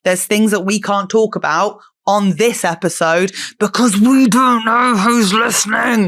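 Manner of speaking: loud, upbeat promo voice; podcast ad read. Acted. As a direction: sell the Patreon with energy.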